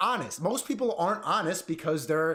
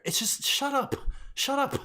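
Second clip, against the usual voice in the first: higher, curt shut up; mock dismissive viewer; 7 semitones higher than usual. Imitating viewers who hate big words, mock curt shut up. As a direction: brush it off like a bored viewer.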